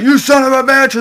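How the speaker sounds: loud, high furious yell; Alvida's angry yell. Retold or imitated. Alvida yelling at Luffy as she throws her club, loud and high. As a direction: scream it at him, enraged. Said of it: "Alvida is like".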